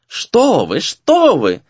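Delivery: high-pitched, friendly hostess fussing; cheerful, insistent refusal; smiling protest. A narrator's reading. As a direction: wave away the offer with a smile. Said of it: "улыбаясь".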